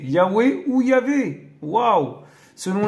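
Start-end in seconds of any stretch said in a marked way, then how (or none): 0.0-1.5 Yahweh ou Yahvé said slowly and higher, each name set apart; careful pronunciation. Shown as a demonstration. Presenting the true name of God to viewers, slow and careful. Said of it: "la vérité".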